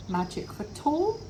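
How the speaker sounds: ordinary delivery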